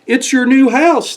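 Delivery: high, excited call; happy shout. Acted. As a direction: announce the surprise gleefully. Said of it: "they all shouted".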